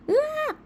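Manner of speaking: sudden very high shriek; child's yelp. A narrator's reading. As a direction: shriek out in alarm. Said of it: "소리를 지릅니다".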